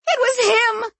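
very high accusing scream. A narrator's reading. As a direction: scream it, pitched right up. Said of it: "she screamed".

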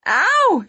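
high, loud "ow"; sharp yelp of pain; pained yell. A narrator's reading. Woman whose arm is being hurt, high pained yell. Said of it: "she yelled".

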